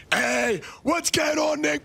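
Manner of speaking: loud, high hype greeting; hype shout. Real, not acted. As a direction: yell it out to the chat, pumped up. Streamer shouting viewer greeting.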